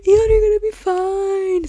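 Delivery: high, loud wailed reassurance; mock sobbing. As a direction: wail it, re-enacting a tearful fan meltdown. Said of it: "'cause I was sobbing".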